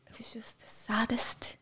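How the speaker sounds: a near-silent whisper of real grief. Real, not acted. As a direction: let the words almost vanish in sorrow. Grief recalling her mother's funeral.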